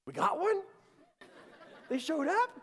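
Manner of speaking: very high, loud astonished questions; amazed disbelief. Retold or imitated. Husband learns the guardian angel came, amazed disbelief. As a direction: squeal it in comic astonishment.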